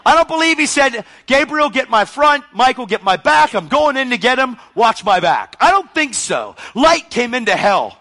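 high, loud pulpit declaration; fervent, shouted sermon; full-force preaching. Real, not acted. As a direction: preach it at the top of your voice.

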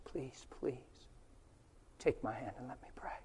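breathy whispered plea, near tears; whispered pleading. Retold or imitated. Preacher to a cancer patient on a plane, whispered pleading. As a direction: beg him in a whisper to let you pray. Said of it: "I just pleaded".

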